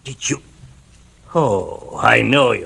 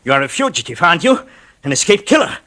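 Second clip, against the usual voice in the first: gloating; 5 semitones higher than usual. Acted. Gloating threat.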